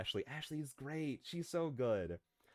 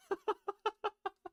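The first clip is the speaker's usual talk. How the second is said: high laugh burst. Real, not acted.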